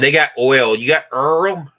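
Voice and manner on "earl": "Earl" said as one r-coloured syllable in place of oil; regional pronunciation. Retold or imitated. Asking a friend about a regional word, regional pronunciation. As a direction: say "Earl" the way some people say oil. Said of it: "ever hear people say it that way".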